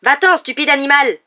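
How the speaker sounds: loud, high-pitched scolding; angry shout. A narrator's reading. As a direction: shout to chase an animal off. Ron shouting at the attacking cat.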